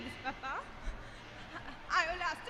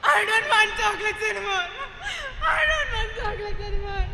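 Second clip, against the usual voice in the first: crying outburst; 7 dB louder than usual. Acted. Child breaks down crying.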